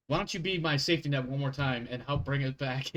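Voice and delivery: ordinary talk, nothing marked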